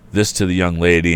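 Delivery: usual speaking voice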